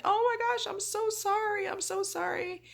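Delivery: high, panicked apologizing; frantic apology. Retold or imitated. Patient apologizing to the surgical team, high and frantic. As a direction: squeak out a flustered apology. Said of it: "and I said".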